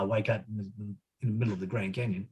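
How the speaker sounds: ordinary delivery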